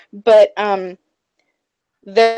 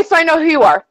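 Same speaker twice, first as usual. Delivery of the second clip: usual speaking voice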